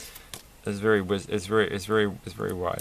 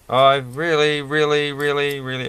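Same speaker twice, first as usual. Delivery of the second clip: high, loud burst of exasperation, easing off; exasperated groan of reallys; 6 semitones higher, 6 dB louder than usual. Real, not acted. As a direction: pile up the reallys in frustration. Frustrated at a piece not fitting.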